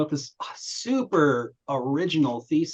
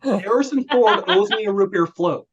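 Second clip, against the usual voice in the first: laughing punchline; 6 semitones higher, 6 dB louder than usual. Real, not acted.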